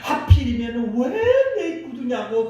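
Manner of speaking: a touch of bitter complaint; grumbling. Retold or imitated. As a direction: whine about losing his shoes. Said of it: "투덜거리면서".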